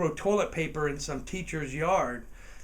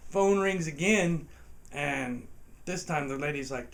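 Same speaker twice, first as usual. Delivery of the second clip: ordinary delivery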